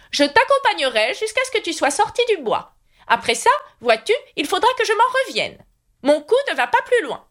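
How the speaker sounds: put-on high, fussy old knight voice; high knightly voice promising to escort Alice. A narrator's reading. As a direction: play the kindly, dotty knight up high.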